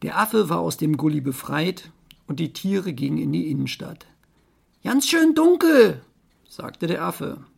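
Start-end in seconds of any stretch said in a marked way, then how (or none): 4.8-6.0 high, loud monkey voice. Acted. Monkey remarks on the dark, high and loud. Monkey's line in read story.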